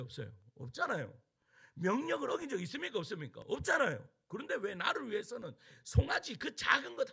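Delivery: loud, high-pitched indignant protest to his father; angry elder son. Acted. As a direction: act out the resentful son, building to a shout. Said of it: "이렇게 화를 냅니다". (아버지에게 화내며 따지는 큰아들의 격앙된 목소리)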